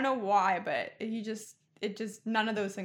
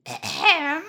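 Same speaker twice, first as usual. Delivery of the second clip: pointed fake ahem, higher and louder; loud mock throat-clear; 7 semitones higher, 10 dB louder than usual. Emily interrupting a kissing couple, with a loud mock ahem. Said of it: "she's like".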